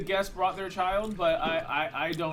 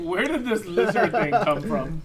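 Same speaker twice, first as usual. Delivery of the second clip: alarmed question; 5 semitones higher, 6 dB louder than usual. Acted. NPC alarmed at kobold.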